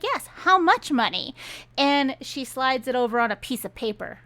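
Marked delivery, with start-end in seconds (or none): 0.0-1.3 eager. Retold or imitated. Player eagerly asks about payment.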